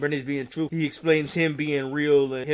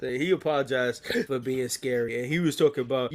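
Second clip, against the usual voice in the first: usual speaking voice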